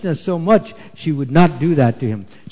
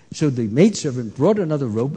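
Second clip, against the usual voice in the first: ordinary delivery, nothing marked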